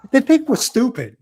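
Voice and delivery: loud exasperation. Real, not acted. Venting about being treated stupid.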